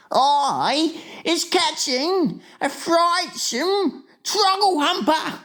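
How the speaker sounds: high, slow, dramatic giant; horrified cry. A narrator's reading. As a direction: announce it as pure horror. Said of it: "he cried".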